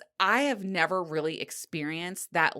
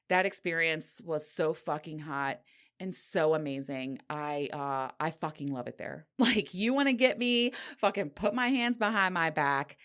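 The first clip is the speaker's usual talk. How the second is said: normal talking voice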